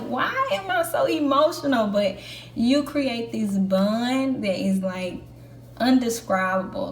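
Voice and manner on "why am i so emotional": high, baffled self-question; lightly voiced disbelief at her own feelings; bewildered. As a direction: ask it like she can't believe her tears. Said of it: "I was like".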